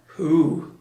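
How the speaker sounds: measured, mildly solemn address; name him gravely, falling tone. A narrator's reading. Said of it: "said Christopher Robin solemnly".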